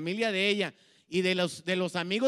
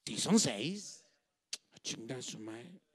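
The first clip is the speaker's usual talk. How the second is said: put-on drunken grumble; 7 semitones lower, 6 dB quieter, slower than usual. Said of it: "en mi borrachera".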